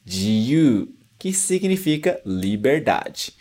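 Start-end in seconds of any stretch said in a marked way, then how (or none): 0.0-0.9 "jiyu" as ji-yu, sounds separate; teacher models "jiyu" with ji and yu apart; uncontracted jiyuu. Shown as a demonstration. Teacher showing freedom word: "jiyu" split ji-yu. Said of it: "A gente separa o ji e o yu".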